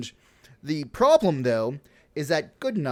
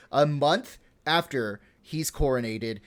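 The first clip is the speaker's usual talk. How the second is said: ordinary talk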